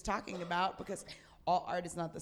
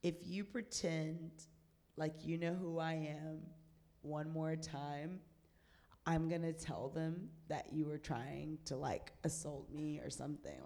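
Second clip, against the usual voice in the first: calm, deadly warning; quiet threat; 4 semitones lower, 6 dB quieter than usual. Retold or imitated. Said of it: "And I said".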